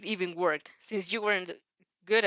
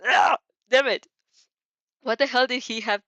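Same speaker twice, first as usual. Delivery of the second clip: loud angry groan; frustrated outburst; 4 semitones higher, 8 dB louder than usual. A narrator's reading. Katsuki fuming at the restless nerd in class. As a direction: snap it out in frustration.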